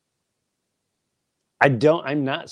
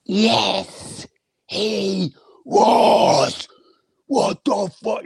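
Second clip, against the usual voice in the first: high, strained angry retort; retold outburst; 11 semitones higher, 5 dB louder, much slower than usual. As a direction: snap back in disbelief.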